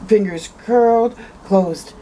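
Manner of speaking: usual speaking voice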